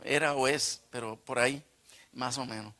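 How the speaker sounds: normal talking voice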